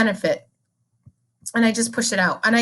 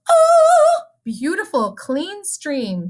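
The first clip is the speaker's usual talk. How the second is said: delighted gasp; 9 semitones higher, 4 dB louder, much slower than usual. Acted. Delighted at clean stream.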